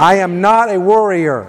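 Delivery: firm, projected declaration; like you mean it. Shown as a demonstration. Preacher leads congregation in declarations against worry, firm and meaning it. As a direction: declare it with conviction for a crowd to repeat. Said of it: "say it like you mean it".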